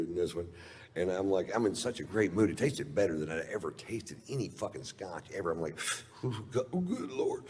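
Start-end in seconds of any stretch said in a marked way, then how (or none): 6.2-7.4 high, hushed swoon over a drink; acted awe. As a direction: gasp it out, high and overcome. Acting out first sip of great Scotch.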